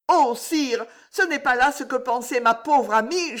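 a hint of a young woman in love; Juliette's voice.

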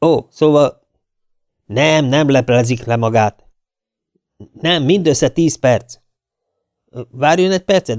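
lightly acted official on the phone; faked phone conversation. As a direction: react to an unheard caller, pausing between replies. One-sided staged phone call.

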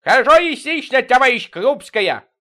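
very loud, high yell; drawn-out hollered greeting; bawling. A narrator's reading. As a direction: bellow it down the receiver. Витёк bawls into the phone.

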